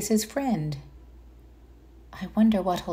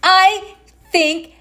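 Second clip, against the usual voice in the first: happy little pig; 11 semitones higher, 6 dB louder, much slower than usual. Acted. Pig smiling, voiced by reader.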